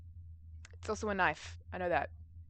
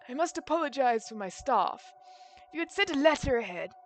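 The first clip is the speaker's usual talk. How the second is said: put-on crabby old man; 5 semitones higher than usual. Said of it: "that's the crabby old man".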